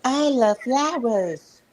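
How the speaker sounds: high, slow, drawn-out character voice; Fufa. Acted. A streamer trying an impression, high slow Fufa. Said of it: "I can do Fufa too".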